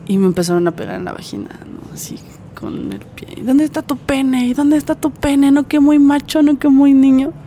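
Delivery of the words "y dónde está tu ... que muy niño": mocking taunt. Attackers jeering at her while beating her, high mocking taunt. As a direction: sneer like the attackers did, voice pitched up.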